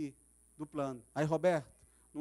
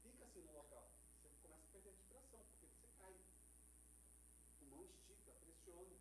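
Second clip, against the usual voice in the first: faint, airless whisper of a suffocating man; breathless demo; 27 dB quieter than usual. Shown as a demonstration. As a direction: show how breath runs out on the cross. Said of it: "respira assim".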